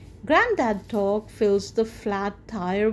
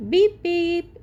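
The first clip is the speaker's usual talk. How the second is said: car horn beep; 8 semitones higher, 5 dB louder than usual. Acted.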